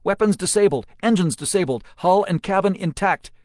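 loud, high gleeful report. A narrator's reading. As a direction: report it with relish. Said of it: "the glee evident in her voice".

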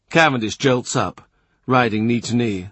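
ordinary delivery, nothing marked

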